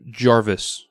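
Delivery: normal talking voice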